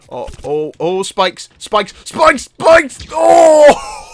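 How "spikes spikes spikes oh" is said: rising, louder repeats into a scream; escalating alarm to a yell; mounting panic. Real, not acted. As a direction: warn frantically, then scream. Panics heading into spikes.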